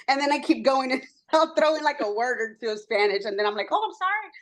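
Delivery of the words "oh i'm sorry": high, light retold apology; apologetic aside in a raised pitch; mock apology. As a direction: act out a quick little sorry. Acts out apologising for mixing languages.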